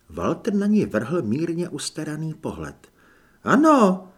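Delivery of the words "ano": obliging helper. A narrator's reading. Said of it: "přikývl".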